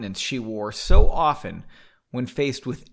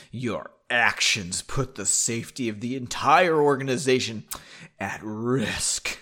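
disgusted; 3 semitones lower than usual. Acted. Council member scolds Margot with more disgust. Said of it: "Was that more disgusting?".

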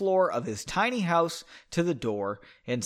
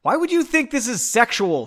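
higher, louder acted outburst; affronted, raised-voice question; indignant yell; 8 semitones higher, 6 dB louder than usual. A narrator's reading. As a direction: yell it as an offended accusation. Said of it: "she yelled".

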